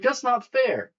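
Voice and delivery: high, loud imitation of a sore loser; mock whining protest. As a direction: whine it loudly in mockery. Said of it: "You're like".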